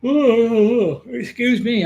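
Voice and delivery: high yawning "Oh" then apology. Real, not acted. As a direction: yawn out loud mid-sentence. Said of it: "I'm yawning today".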